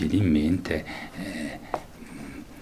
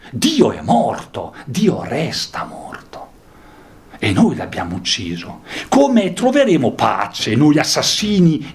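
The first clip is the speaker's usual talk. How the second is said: higher, louder declaiming; dramatic reading; 10 semitones higher, 7 dB louder than usual. Acted. Teacher rereading the madman's words to students, declaiming. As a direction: proclaim the death of God. Reading the text aloud again.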